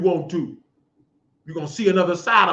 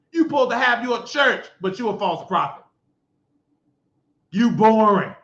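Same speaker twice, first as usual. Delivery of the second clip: ordinary talk, nothing marked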